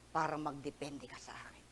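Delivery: whispered, tender and close. Real, not acted. Preacher whispering God's tender promise to the congregation. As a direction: breathe it softly like a secret. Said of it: "whispered tender line in sermon".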